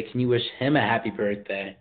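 usual speaking voice

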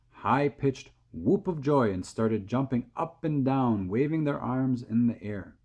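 plain reading voice

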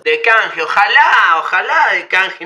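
loud, insistent bargaining cry; loud exclamation. Real, not acted. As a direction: shout it like a haggling cheapskate. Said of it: "entré en modo rata". (grito insistente de regateo)

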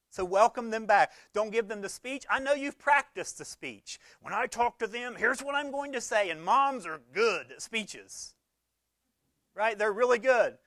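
loud, high preaching voice pushed hard; full-force pulpit preaching; fired-up preaching. Acted. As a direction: preach it out to the whole room.

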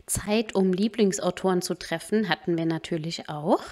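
soft, even recap voice-over; quiet vlog voice-over; voice-over narration. Acted. Voice-over recap between live clips.

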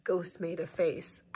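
put-on slow storybook reading for kids; picture-book narration; read-aloud narration. A narrator's reading.

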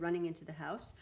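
usual speaking voice

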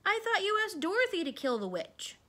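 high-pitched, startled question; puzzled surprise, pitch jumping up; surprised. A narrator's reading. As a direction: say it high, taken aback. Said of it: "said the Scarecrow in surprise".

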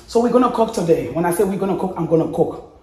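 ordinary talk, nothing marked

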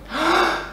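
sharp full inhale with no hook; quick inhale, no hook; plain full inhale. Shown as a demonstration. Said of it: "And without it".

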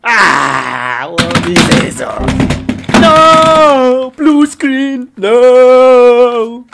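very loud, high screams of despair; anguished screaming. Acted. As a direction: scream it out in total meltdown. Computer crash, screaming in rage.

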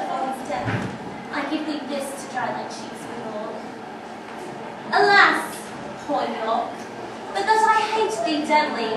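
proud, scornful queen mocking a captured enemy; Queen Margaret taunting York. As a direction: declaim it like a vengeful queen gloating over her prisoner. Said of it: "I'm actually playing the role of Queen Margaret".

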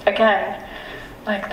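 ordinary delivery

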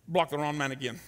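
ordinary talk

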